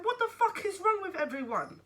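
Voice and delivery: high, loud, bewildered protest; exasperated outburst. Retold or imitated. Mother venting to her support worker, high exasperated outburst. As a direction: demand it in high-pitched disbelief.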